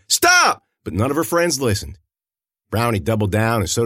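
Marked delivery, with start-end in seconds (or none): 0.1-0.7 sharp high shout, much louder; yelled. A narrator's reading. The girl yells at her friends to stop. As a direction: yell it to halt her friends. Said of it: "she yelled".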